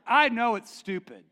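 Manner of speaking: ordinary talk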